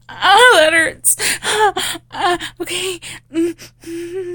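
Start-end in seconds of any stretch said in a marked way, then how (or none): 0.1-3.0 high, loud pained cry and gasp; mock pained cry. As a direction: cry out as if it hurts. Roleplay pain cry.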